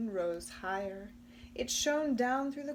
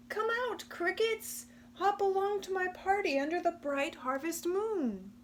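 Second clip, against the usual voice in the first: much higher, coaxing call; cheery calling; 10 semitones higher, 4 dB louder than usual. Acted. Possum coaxing crickets out of the leaf pile, high and cheery. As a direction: coax the crickets out. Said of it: "Possum peered into leaves".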